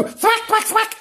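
high, sharp sound-effect words; squeaky mimed whacks; thwacking. Acted. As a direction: punch out each blow up high. Imitates blows of broom handle.

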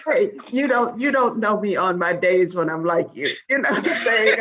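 talking through laughter; chuckling while speaking; laughing. Real, not acted. Laughing through friendly banter.

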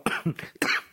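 sudden choking cough on air; real coughing fit mid-sentence. Real, not acted. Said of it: "je m'étouffe".

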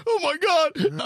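imitating a stranger's stunned, high gasp of horror; shocked cry. Stranger told of wife's death, mock shocked cry. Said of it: "He's like".

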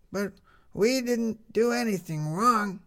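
put-on high, slow child voice; small girl's high, earnest protest; young girl Lizzie. A narrator's reading. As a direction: play the little girl protesting.

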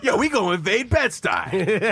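high, slow comic caricature; hammed-up drawn-out announcement.

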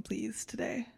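soft whispered please. Real, not acted. Host asking the guest's drink, whispered. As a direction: whisper it as an aside.

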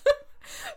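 high burst of laughter; laughing. Real, not acted. Storyteller laughing at her own outburst, high giggle. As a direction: crack up at yourself.

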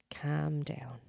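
unvoiced, hushed calm down; mock soothing whisper. Shown as a demonstration. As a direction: breathe it out softly, almost nothing. Quoted phrase said hushed.

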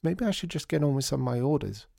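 high, fretful and flustered; distraught. A narrator's reading. Ivy fretting to Lydia about scaring a customer, high and distraught. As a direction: pour out the worry, a touch frantic. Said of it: "she wailed".

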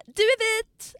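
högt, gällt härmat utrop; härma kompisens upprörda protest, högt i både tonläge och volym; återgivet utrop. Retold or imitated. (härmande, upprört utrop)

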